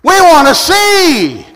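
loud, high, slow cry. Acted. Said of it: "They said".

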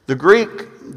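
ordinary talk, nothing marked

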